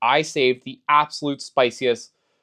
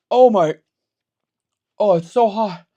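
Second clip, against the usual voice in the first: in real pain; 6 semitones higher, 5 dB louder than usual. Real, not acted. Burning from hottest sauce.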